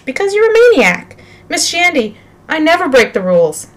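high, loud protest easing down; exasperated high-pitched self-defence; indignant outburst. Acted. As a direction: burst out high, then plead innocence. Accused boy argues before teacher.

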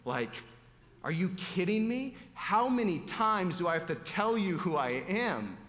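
incredulous, pitch leaping up on the questions; exasperated. Acted. Preacher voices Jesus fed up with the people, exasperated. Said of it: "Can't you just see him like".

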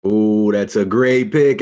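loud, high, drawn-out admiration; impressed excitement. Real, not acted. Podcaster reacting to co-host's Sydney pick, loudly impressed. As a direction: really relish the pick, slow and loud.

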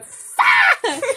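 loud, high mock yell through laughter; hammed-up screaming fit; laughing mock shout. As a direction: yell it like a tantrum while laughing.

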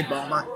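"Balmer" squashed to two syllables, no T; Baltimore local pronunciation. Shown as a demonstration. Host showing the local name, "Balmer" in two syllables. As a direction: swallow the middle of "Balmer", locals' way of saying Baltimore. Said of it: "and also we say Balmer".

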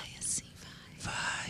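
hushed, intimate whisper; ASMR whisper. Shown as a demonstration. Guest performs ASMR for the hosts, soft whisper. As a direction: whisper breath-light into the microphone. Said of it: "sussurrar assim no seu ouvido".

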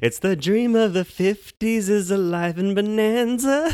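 high, slow grand pronouncement; mock grand announcer. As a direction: proclaim it like a slogan. Slow sung-like catchphrase.

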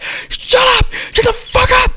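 very high, screamed mock outburst; acted exasperated shout. Imagining snapping at co-host during the game, hammed-up shout. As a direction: blow up at him in a shrieked yell. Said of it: "I'm gonna be like".

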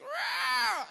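high, loud cat screech; screeching cat noise. Retold or imitated. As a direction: imitate a cat screeching at full volume. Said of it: "screeching cat noise".

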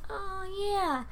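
high, sing-song mock of a nosy woman; mocking women. Imitating women at work, high and sing-song. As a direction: chirp it like a know-it-all. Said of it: "when women are like".

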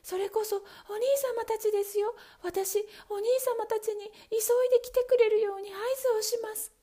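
put-on high, eager young wife; wife eager to signal her brothers; hopeful wife. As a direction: say it with rising hope. Wife voiced by narrator.

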